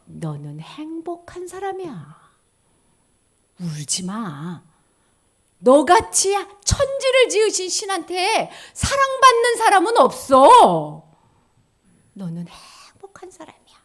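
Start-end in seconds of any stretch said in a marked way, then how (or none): 0.0-4.6 tender reading. Shown as a demonstration. Blessing read aloud, first take. 12.1-13.8 whisper. Shown as a demonstration. Blessing repeated, second take.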